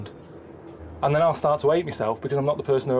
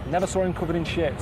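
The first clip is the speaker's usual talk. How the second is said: normal talking voice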